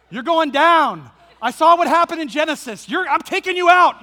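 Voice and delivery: loud, high, hammed-up war cry; battle cry. Dad raising the hoe at the snake, loud mock battle cry. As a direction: roar it like a hero about to strike.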